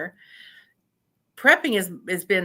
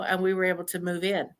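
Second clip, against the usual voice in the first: normal talking voice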